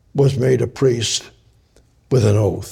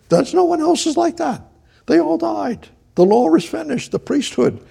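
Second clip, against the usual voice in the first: impassioned preaching. Real, not acted. Preacher insisting no other priest is like him, impassioned. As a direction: voice leaping very high in fervour. Preacher exclaims at climax of point.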